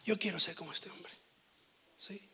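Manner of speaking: real tearful plea, hushed; voice breaking. Real, not acted. Preacher recalling his weeping prayer, voice breaking. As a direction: choke up while pleading softly. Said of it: "se me quiebra hoy mi voz".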